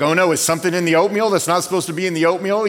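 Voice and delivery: fast, loud alarmed question; mock alarm at his wife's gasp. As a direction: blurt it out in a panic over the oatmeal. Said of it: "And I'm like".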